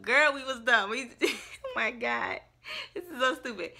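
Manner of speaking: laughing at own foolishness; real laughter breaking through the words; slowed down, laughing at herself. Real, not acted.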